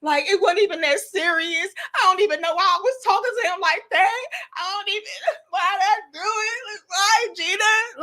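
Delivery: high-pitched whiny regret; hammed-up self-reproach; mad at herself. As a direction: wail at yourself for messing up. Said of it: "I was mad as hell myself".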